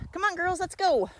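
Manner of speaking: high, singsong summons; calling them along. Real, not acted. Walker calling the girls to come on, high calling voice.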